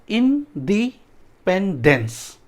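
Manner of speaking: इंडिपेंडेंस slowly: इन, डी, पेन, डेंस; syllable breakdown. Shown as a demonstration. Teacher breaking a long word for students, slow syllables. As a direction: separate all four parts of इंडिपेंडेंस. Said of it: "उसको break कर देते हैं".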